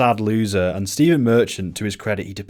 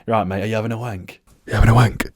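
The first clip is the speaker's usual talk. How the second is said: imitating Ricky; 2 semitones higher, 6 dB louder, faster than usual. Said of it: "I'd just be like".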